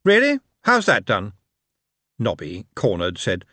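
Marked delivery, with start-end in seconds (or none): 0.0-1.3 sceptical. A narrator's reading. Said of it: "looked sceptical".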